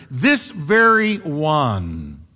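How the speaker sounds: "This very one" drawn out very slowly; emphatic rendering. Shown as a demonstration. Preacher rendering the emphatic Hebrew word, "This very one" slow and weighty. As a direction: stretch each word of "This very one" with heavy emphasis. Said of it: "is emphatic. It means".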